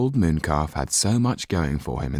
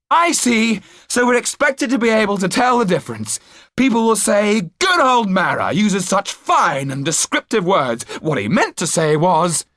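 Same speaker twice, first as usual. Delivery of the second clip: put-on higher, louder, sarcastic young hero; mock the idea with bitter irony; Jan's voice; 5 dB louder than usual. A narrator's reading. Jan sneering at Hulot's naivety, higher and sarcastic.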